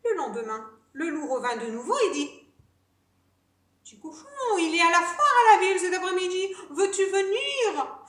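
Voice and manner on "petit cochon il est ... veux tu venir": lightly voiced wolf, higher and louder; a hint of a sly wolf; coaxing wolf. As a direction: call out an invitation to lure the pig. Wolf invites pig to fair.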